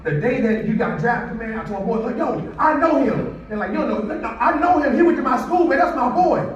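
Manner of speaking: loud, high, hyped-up retelling; imitating an excited bully proudly bragging. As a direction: brag to your friends with pride, voice climbing. [name] retelling bragging to friends.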